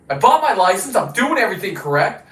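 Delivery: normal talking voice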